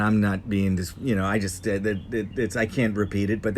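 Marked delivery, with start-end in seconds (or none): none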